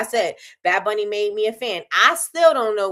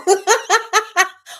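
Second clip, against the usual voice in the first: high, loud laugh; real high laughter; burst of laughter; 10 semitones higher, 6 dB louder than usual. Real, not acted. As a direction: crack up at your own joke. Laughs at her own joke.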